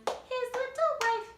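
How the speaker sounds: very high, loud slow wail; shrill hammed-up wail; vocal freak out. Shown as a demonstration. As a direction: freak out on the words. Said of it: "you're gonna have a vocal freak out".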